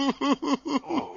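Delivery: high, loud mocking laugh; gloating cackle. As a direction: cackle over his pain. Ghost gloating.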